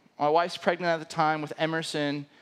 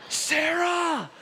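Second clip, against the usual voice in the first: calling out; 12 semitones higher than usual. Retold or imitated. Calling his wife over for help, a high urgent call. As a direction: shout across to get her attention.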